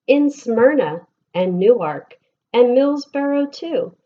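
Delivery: lilting, sing-song picture book reading; gentle storytime voice with bouncing rhythm; reading to children. Acted. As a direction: read the rhymes aloud to a class of kids. Reads picture book to class.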